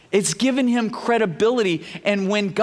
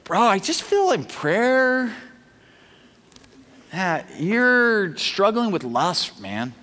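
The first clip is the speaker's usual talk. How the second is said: acted awkward hesitation; slower than usual. Man phoning his friend about a private struggle, awkward. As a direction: drag it out reluctantly, with long pauses. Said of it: "This is an awkward conversation".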